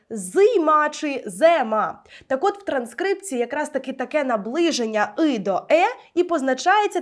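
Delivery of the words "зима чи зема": зима чи зема, said slowly, with the unstressed и pulled towards е; two spellings sounded out. Shown as a demonstration. Teacher showing how a foreigner hears the unstressed vowel. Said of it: "у нього виникне сумнів".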